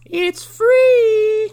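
very high, loud whining creature cry; shrieking creature. Pretend. Dryer monster tempting her, very high loud cry. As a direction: screech the bait.

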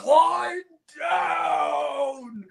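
very slow, high mock shout; drawn-out high yell. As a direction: holler it with long stretched words. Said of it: "then you be shouting".

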